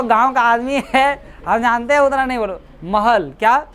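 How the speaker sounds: ordinary delivery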